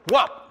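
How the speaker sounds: a loud, high punched whop sound; imitating Papa's one-punch hit. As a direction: smack the sound out like a blow.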